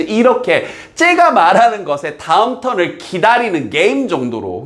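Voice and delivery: usual speaking voice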